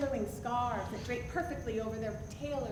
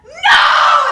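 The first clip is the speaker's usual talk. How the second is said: high, full-force scream of refusal; top-of-the-lungs yell; 13 semitones higher, 22 dB louder than usual. Retold or imitated. Woman shoving the Frenchman away, full-force yell. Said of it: "and yell".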